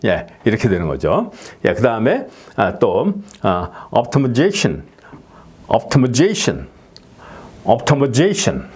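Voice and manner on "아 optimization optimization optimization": very slow optimization, peaking on ZA then falling; stress on fourth syllable. Shown as a demonstration. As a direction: optimization drawn out, level then up on ZA. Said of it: "두 번 이렇게 평지로 갔다가 올라갔다 내려오는".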